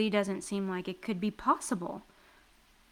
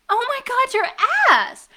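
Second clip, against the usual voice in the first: very high then loud outburst; shocked exclamation; 13 semitones higher, 11 dB louder than usual. Acted. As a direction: burst out in disbelief. Sees his inflated behind.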